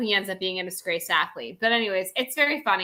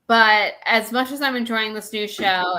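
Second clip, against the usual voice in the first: ordinary talk, nothing marked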